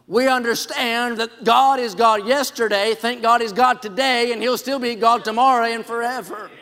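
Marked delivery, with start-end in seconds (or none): none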